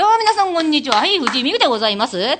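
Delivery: high, loud stage greeting; playing along as emcee. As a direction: join in the bit like a comedy host. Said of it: "あ、じゃあ私も乗りますわ". (乗っかった司会風の挨拶)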